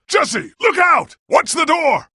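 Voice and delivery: high, loud, urgent alarm; shouted warning. Acted. Warning Jesse of danger in battle, shouted. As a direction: yell a warning across the room.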